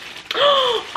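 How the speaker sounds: sharp high gasp; excited gasp. Real, not acted. Opening a friend's handmade ornament, gasping. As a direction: gasp at the surprise.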